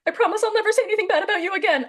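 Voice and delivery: laughing. Real, not acted. Retelling her awkward promise to Jax, laughing. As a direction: giggle out the embarrassing promise.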